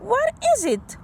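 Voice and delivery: lightly voiced curious mother; Mom asking. Mom asking child about his machine, curious. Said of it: "Mom asked".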